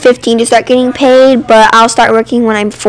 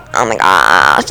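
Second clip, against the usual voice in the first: very low vocal fry imitation; mock her annoying creaky drawl; creaky, put-on "talk like that" voice; imitating Olivia; 4 dB quieter, slower than usual. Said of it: "she's starting to talk like".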